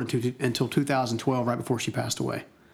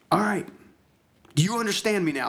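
high, insistent scolding; acted-out yell, not at full volume; imitated yelling; 7 semitones higher than usual. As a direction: demand an answer with a raised pitch. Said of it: "she's, like, yelling at me".

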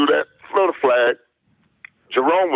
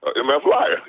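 ordinary talk, nothing marked

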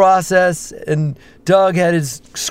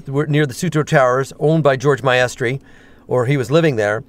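ordinary talk, nothing marked

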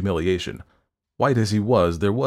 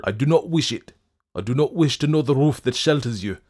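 loud, raised, emphatic refusal; forceful interruption; 6 semitones higher, 5 dB louder than usual. A narrator's reading. Fettes refusing the doctor's address, forceful interruption. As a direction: cut in hard with rejection. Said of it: "interrupted the other".

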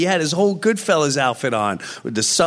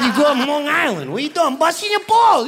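Joe Pesci impression; 7 semitones higher than usual. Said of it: "That was an incredible impression".

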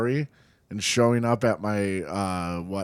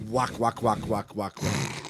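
spoken whack sounds, fading softer; mimed gunshots; 2 semitones lower, 6 dB quieter than usual. Pretend. Mimed gunshots for friends, fading softer. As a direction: tap out the gunshots flatly. Miming shots after luring enemies.

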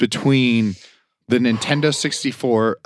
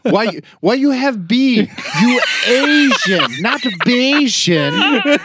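high, slow, hammed-up immigrant-parent voice; jokey caricature of a strict dad scolding over a B; mock dad accent voice; 12 semitones higher, 4 dB louder, slower than usual. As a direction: lay on a thick mocking parent accent, drawn out. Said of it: "So I don't know why he talks like that".

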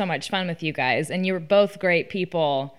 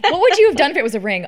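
fast, loud and high through laughter; laughing; 7 semitones higher, 6 dB louder, much faster than usual. Real, not acted. Friend asks about the ring, fast and laughing. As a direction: blurt it out while laughing.

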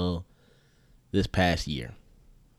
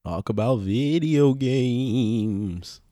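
slow drawn out; 3 semitones higher, 4 dB louder, much slower than usual. Acted. Co-host delivers show catchphrase.

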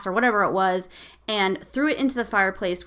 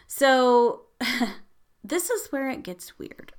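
ordinary talk

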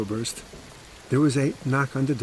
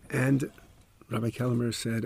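usual speaking voice

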